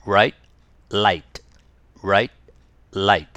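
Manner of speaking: Right said with a curled R, light said with the tongue on the ridge; R and L minimal pairs. Shown as a demonstration. Teacher leading the class in R L drills. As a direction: contrast R and L word by word. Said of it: "はい、一緒に。".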